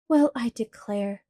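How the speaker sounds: plain reading voice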